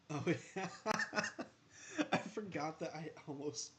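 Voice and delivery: can't stop laughing at own blunder. Real, not acted. Streamer laughing at nearly cutting his player.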